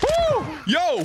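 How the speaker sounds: very high yelling cheer; excited whoop. Real, not acted. Celebrating a kill.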